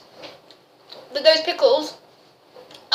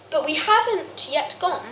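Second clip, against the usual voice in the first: normal talking voice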